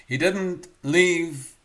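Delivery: slow, loud, weighted stress on leave; preacher punching the point home; emphatic stress. Acted. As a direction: lean hard into every word. Sermon emphasis.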